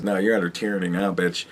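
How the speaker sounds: low, soft and fast, a menacing sneer; menacing taunt. Retold or imitated. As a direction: hiss it down at the people like a tyrant. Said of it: "make 'em realize".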